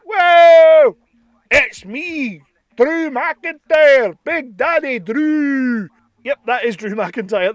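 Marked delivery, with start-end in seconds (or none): 0.0-1.0 loud, high whoa; big burst of amazement; surprised whoa. Real, not acted. Seeing the toy revealed, a loud surprised whoa. 1.5-5.9 loud, drawn-out Scottish announcement; hammed-up Scottish wrestler; Scottish accent. Playing the wrestler he unwrapped, booming Scottish accent. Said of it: "should be a much better Scottish accent".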